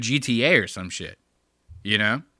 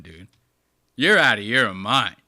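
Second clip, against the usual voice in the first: usual speaking voice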